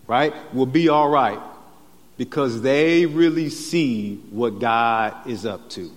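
slow, deliberate, spelled-out reading; slow translation. Shown as a demonstration. Preacher slowly restating the verse for everyone, slow and deliberate. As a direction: unpack each phrase slowly for the congregation. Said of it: "a slower translation would be".

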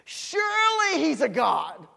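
taunting, slow, loud mock proclamation; Elijah. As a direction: stretch it out in a sarcastic shout. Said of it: "Elijah began to taunt them".

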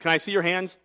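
ordinary delivery

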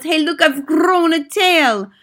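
high-pitched girl, witch-mimicked; alarmed yell. A narrator's reading. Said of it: "She yells".

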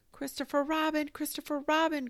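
high, loud squealed appeal; little creature calling urgently for help; crying out. A narrator's reading. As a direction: cry the name out high. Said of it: "cried Piglet".

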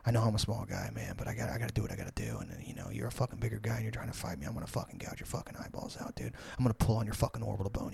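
low, very soft imitation of muttered justification; under his breath. Frankie explaining to his friend how he'd fight a bigger guy, muttering under his breath. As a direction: mutter rapid threats like a friend explaining himself quietly. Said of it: "I hear him under his breath".

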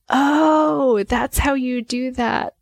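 high, bright "Oh" of sudden discovery; a child suddenly getting it, voiced in awe; daughter's wide-eyed realization. Mom voicing her daughter's surprised discovery, high and amazed.

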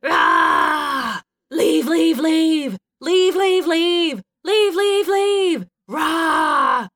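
loud, high, climbing chant; death-metal chant broken by roars; screaming death metal. A narrator's reading. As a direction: scream it in pounding waltz triplets. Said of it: "like a screaming death metal waltz".

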